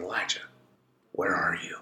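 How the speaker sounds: a soft low call of "Elijah", then a whispered "where are you"; whispered still small voice. Acted. The Lord calls Elijah in a still small voice. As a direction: hush it like a gentle call in the dark. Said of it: "in his still small voice".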